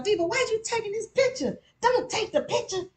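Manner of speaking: high, fast scolding inner voice; scolding herself over the picture. Retold or imitated. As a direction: nag yourself in your head. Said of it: "I'm thinking to myself".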